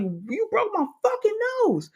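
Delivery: high-pitched retold outcry; outraged disbelief. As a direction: act out a shocked accusation, pitch way up. Acted cry after being hit.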